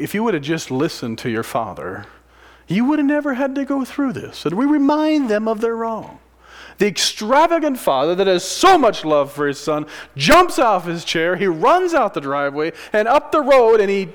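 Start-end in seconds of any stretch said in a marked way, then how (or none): 2.7-14.1 high, loud preaching at full force; fiery preaching. Acted. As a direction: preach it hard to the congregation. Preaches the father's love.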